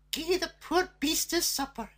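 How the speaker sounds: put-on old woman's voice. A narrator's reading. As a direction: urge the girl kindly.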